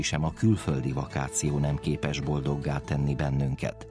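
normal talking voice

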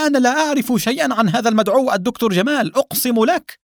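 high, fast, terrified protest; frightened, rushed high-pitched plea; panicked cry. A narrator's reading. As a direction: swear innocence in a high panic. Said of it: "صاح الشاب بفزع".

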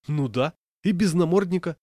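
high, loud indignant protest; indignant alarm. A narrator's reading. Passenger objecting to an unmuzzled dog, indignant. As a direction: protest loudly, voice jumping up.

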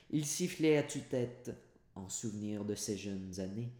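plain reading voice

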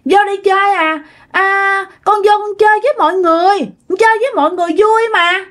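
put-on high-pitched child refusing to leave; little child's voice. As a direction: whine to stay and play, like a small kid. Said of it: "giọng một đứa con nít".

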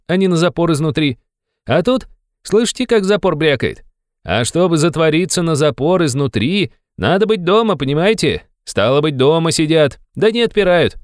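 high, insistent explaining; excited young man. A narrator's reading. (горячо объясняет высоким молодым голосом)

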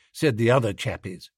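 usual speaking voice

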